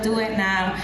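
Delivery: ordinary talk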